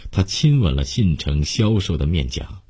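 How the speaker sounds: usual speaking voice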